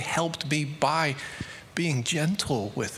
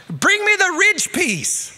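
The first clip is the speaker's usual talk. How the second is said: even higher, louder, exasperated call, dragging; louder shouted order; 8 dB louder, slower than usual. Retold or imitated. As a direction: yell it again, losing patience. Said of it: "he shouted out to me".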